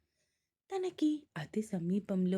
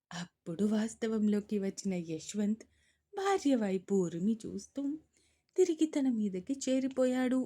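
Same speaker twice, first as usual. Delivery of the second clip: ordinary delivery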